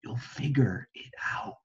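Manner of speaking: soft unvoiced whisper. Parents whisper to their sleeping daughter, tender whisper. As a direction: breathe it gently at a sleeping child. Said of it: "They kissed her and whispered".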